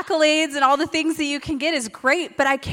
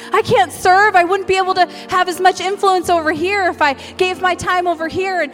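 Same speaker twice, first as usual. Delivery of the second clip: louder, higher protesting excuses; mock excuses; 5 semitones higher, 5 dB louder than usual. Preacher voicing listeners' fearful excuses, mock protest. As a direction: rattle off the excuses mockingly. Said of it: "when we're like".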